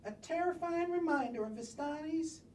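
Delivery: ordinary delivery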